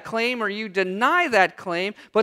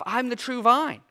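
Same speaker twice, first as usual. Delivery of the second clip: building, louder list of claims; preaching; 4 semitones higher, 5 dB louder than usual. Acted. Preacher building the I am sayings to the church. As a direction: lift each I am higher as the list builds.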